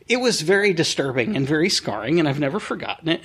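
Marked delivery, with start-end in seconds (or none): none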